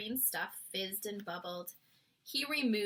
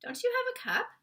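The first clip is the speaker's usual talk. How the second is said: put-on much higher girl's voice; a small girl asking; 14 semitones higher, 4 dB louder, much faster than usual. A narrator's reading. Sophie voice.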